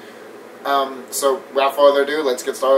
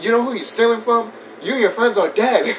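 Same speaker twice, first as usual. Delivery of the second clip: raised-pitch mock threat; higher, put-on movie-quote voice; acted movie threat; 7 semitones higher than usual. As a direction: play a worked-up character in a retold scene. Acts out the bank robbery scene.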